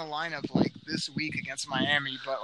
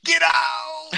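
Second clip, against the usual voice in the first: very high, loud shout; 14 semitones higher, 7 dB louder than usual. Acted. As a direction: explode into the catchphrase. Get out catchphrase shout.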